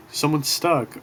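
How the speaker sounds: normal talking voice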